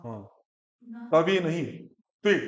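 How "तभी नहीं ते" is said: ordinary talk, nothing marked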